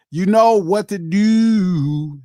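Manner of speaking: put-on slow, drawn-out Baltimore accent. Shouting out a donor in a Baltimore accent. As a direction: stretch it out like a Baltimore local. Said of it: "That's how all the Baltimore people talk".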